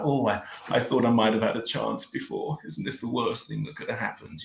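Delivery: a hint of a weepy Hollywood star; mock heartbroken Cameron Diaz. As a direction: play a mock-tragic film star lamenting her lost chance. Mock Cameron Diaz pining over news.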